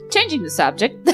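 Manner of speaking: usual speaking voice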